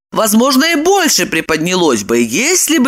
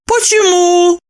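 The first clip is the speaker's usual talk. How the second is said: very high surprised question; 12 semitones higher, 4 dB louder, slower than usual. A narrator's reading. Lipochka does not see herself so, surprised. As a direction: ask in high-pitched puzzlement. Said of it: "удивилась она".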